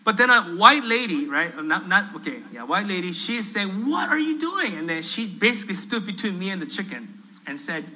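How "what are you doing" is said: high, mock-outraged question; high-pitched retold indignation; indignant challenge. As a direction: imitate an appalled passer-by confronting someone. Outraged lady confronts him, acted.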